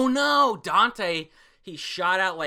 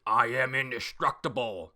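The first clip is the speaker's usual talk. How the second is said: a hint of a gloating villain, loud at the end; lightly voiced villain declaring victory; mild villain gloat; villain boast; 2 semitones higher, 10 dB louder than usual. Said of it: "Dante's like".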